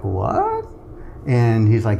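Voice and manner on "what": mock gasp of disbelief, pitch flying up; stunned what. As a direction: her jaw-dropping reaction, retold. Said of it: "And she's like".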